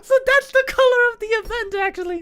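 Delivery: very high, loud squealing; overjoyed near tears. Real, not acted. Gamer getting two on the event, overjoyed squeal near tears. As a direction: squeal as if about to cry with joy. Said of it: "I'm gonna cry right now".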